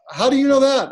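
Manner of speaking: high, loud amazed question; astonished child. Retold or imitated. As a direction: ask it like a startled kid who can't believe it. Daughter amazed at father's knowledge.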